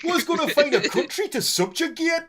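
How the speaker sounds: high, loud comic Geordie; mock Geordie Nazi, pitched right up; Sarah Millican. Nazi asking colleagues for jobs, high loud Geordie voice. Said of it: "Nazis played by Sarah Millican".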